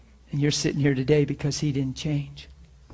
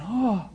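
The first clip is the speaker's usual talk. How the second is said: high swooping oh of sympathy; retold pitying "oh"; 7 semitones higher than usual. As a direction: act out a heartfelt oh. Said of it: "saw somebody and just went".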